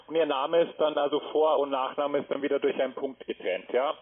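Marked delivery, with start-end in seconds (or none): none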